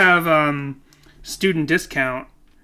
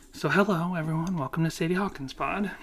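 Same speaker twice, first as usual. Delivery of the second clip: quiet; 9 dB quieter than usual. Shown as a demonstration. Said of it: "Just turn it down".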